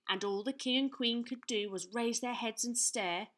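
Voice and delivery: ordinary delivery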